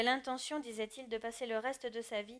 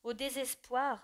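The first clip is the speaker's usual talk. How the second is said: plain reading voice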